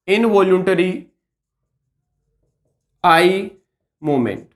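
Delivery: slow, separated words with pauses between; slow dictation. Shown as a demonstration. Teacher repeating a medical term for students, slow dictation. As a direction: spell out "involuntary ... आई ... movement" word by word, dropping on "movement".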